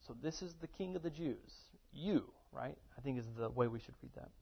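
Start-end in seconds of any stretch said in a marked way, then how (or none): 0.1-2.3 mocking and belittling. Shown as a demonstration. Pilate mocking Jesus before him, softly belittling. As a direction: sneer down at the prisoner, half under your breath. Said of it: "it's almost mocking and belittling a little bit".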